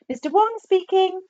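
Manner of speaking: put-on high Chinese-accented phone voice; high caricatured phone voice; voice on the line. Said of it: "said a voice at the other end".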